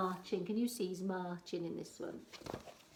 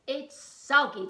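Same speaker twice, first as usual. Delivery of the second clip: pirate complaint; 6 semitones higher, 13 dB louder than usual. Acted. Pirate complains about food.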